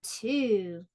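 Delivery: usual speaking voice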